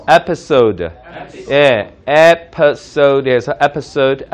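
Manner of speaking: "episode" drawn out very slowly; slow model episode. Shown as a demonstration. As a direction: stretch "episode" out syllable by syllable. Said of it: "이 정도로 발음하면".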